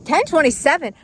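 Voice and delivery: high, loud disbelieving question; shocked at how late it is; surprised. Real, not acted.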